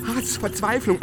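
usual speaking voice

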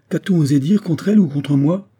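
high, loud, outraged question; indignant cry. A narrator's reading. Edward demands the slander against his wife, crying out. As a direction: demand to know who dared. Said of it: "s'écria Edward".

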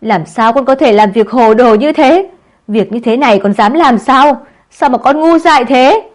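mother wails at her disgraced daughter; crying scolding mother. A narrator's reading. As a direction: scold your daughter through sobs. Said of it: "bổ nhào về phía con gái khóc lóc".

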